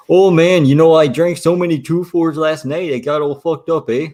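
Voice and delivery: loud, high East Coast Canadian caricature; Nova Scotia accent. Acted. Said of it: "they all sound like that".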